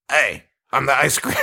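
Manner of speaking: lightly put-on movie character voice; slightly altered voice, played for laughs; ice cream man. Shown as a demonstration. Host shows how easy the actor's character voice is, imitating. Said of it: "you could easily put that voice on".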